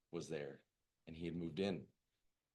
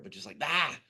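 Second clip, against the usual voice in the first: frustrated groan; 8 semitones higher, 10 dB louder than usual. Retold or imitated. Struggles to voice craving.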